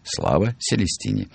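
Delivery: usual speaking voice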